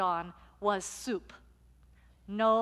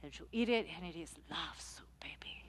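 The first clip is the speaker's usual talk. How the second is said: fading to whisper; 6 semitones lower, 12 dB quieter than usual. Retold or imitated. Luisa tells pastor the secret of her soup, whispered. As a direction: drop away into a hush.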